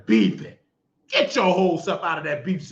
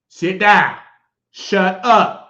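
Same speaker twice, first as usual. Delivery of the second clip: sharp, louder orders to sit and hush; loud scolding command; 6 dB louder, much slower than usual. Real, not acted. Preacher loudly orders a mouthy viewer, loud scold. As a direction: snap at her to sit down.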